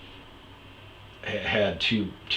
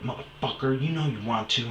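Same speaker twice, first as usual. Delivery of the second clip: higher, goading put-on voice; taunting ex; 6 semitones higher than usual. Ex goading him mid-fight, higher mocking taunt. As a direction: dare him to say it. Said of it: "she goes".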